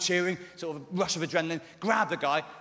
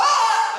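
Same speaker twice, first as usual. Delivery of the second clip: very high, loud mock yell; wordless yell imitating an audience member; imitated shout; 11 dB louder than usual. Comic imitating someone shouting amid the clapping, mock yell. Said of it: "someone just sort of like".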